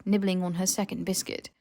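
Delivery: usual speaking voice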